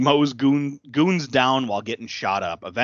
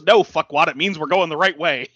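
mock snap; 5 semitones higher, 6 dB louder than usual. Heroine snaps back.

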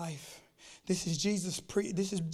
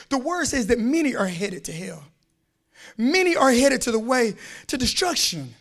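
building from firm to a loud, high shout; full-force preaching; 9 semitones higher, 13 dB louder than usual. Acted. Preacher warning the church, building to a shouted sermon. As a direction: preach it louder and louder until you shout.

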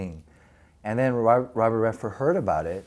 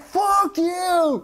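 a much higher, louder yelled curse; mock angry yell; 3 dB louder than usual. Roger running after the truck shouting at them, higher louder yell. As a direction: shout it while chasing the truck.

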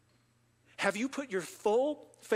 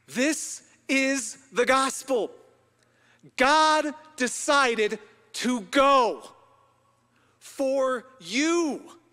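loud, high-pitched, emphatic preaching; full-force preaching; 4 semitones higher, 7 dB louder than usual. Real, not acted. Preacher telling the congregation Jesus went to the cross for them, full-force preaching. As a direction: proclaim it to the crowd with stretched key words.

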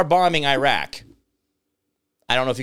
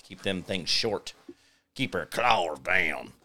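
usual speaking voice

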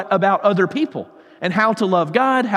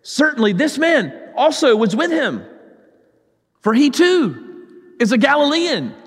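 higher-pitched, pointed accusation read aloud; mildly dramatized insistence; insistent; 7 semitones higher than usual. A narrator's reading. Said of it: "still another insisted".

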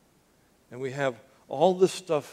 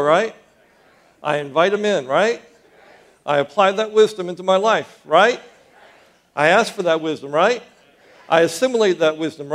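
much louder, pumping up the crowd; louder second try; 3 semitones higher, 9 dB louder than usual. Real, not acted. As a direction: drive each line hard and bounce the "right?" up. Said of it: "So let's try it again".

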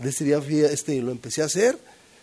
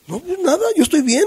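much higher, carefree brush-off; mock breezy denial; 13 semitones higher, 6 dB louder than usual. Mocking his own denial to his sister, breezy. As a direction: play it off like nothing's wrong. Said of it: "ella esperaba".

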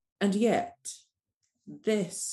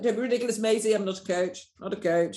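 higher, dismissive retold retort; protesting; 5 semitones higher, 4 dB louder, faster than usual. Doula refusing her friend's coach label, protesting. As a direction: brush off the suggestion, insistently.

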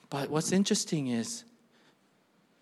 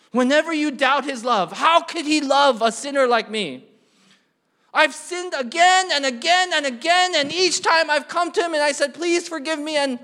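high, loud, urgent pulpit plea; impassioned preaching; 11 semitones higher, 9 dB louder than usual. Real, not acted. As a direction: voice a sinner's doubt at full force. Preaches, voicing a doubting sinner.

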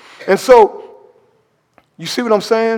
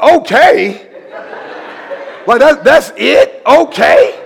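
high, loud disbelieving okay with a laugh; can't believe that's all he said; incredulous okay; 7 semitones higher, 4 dB louder, slower than usual. Retold or imitated. Preacher baffled the officer just said okay, laughing. Surprised at cop's calm reply.

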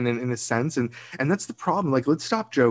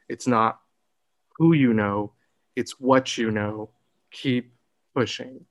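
deliberate, emphatic; slower than usual. Retold or imitated. Telling younger self the lesson, deliberate and emphatic. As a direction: land each phrase separately and firmly. Repeats the advice deliberately, phrase by phrase.

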